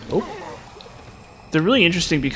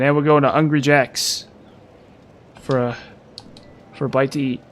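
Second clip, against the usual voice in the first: mock Australian. Streamer goes on with the Aussie bit, mock Australian accent. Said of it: "I can do an Australian".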